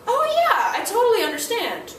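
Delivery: high, loud, eager "oh yeah"; enthusiastic agreement. Retold or imitated. Teacher cheering on an excited student, high and loud. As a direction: gush with delight. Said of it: "and I'll go".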